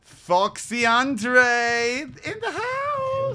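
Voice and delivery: loud, long drawn-out announcement; booming drawn-out introduction; hype announcer. Pretend. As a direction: stretch each word like a hype man. Hyping up a player's arrival.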